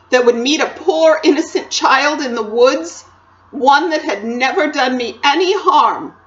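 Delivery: loud, impassioned faun confession; self-loathing faun. Acted. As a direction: pour out guilt in a loud, deliberate lament. Narrator voices confessing faun.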